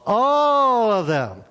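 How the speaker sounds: slow, high, loud and drawn out. Shown as a demonstration. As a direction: proclaim it slowly for all to hear. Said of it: "You're gonna say it out loud".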